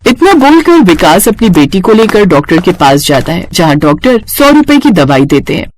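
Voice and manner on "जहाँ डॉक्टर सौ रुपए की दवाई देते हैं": usual speaking voice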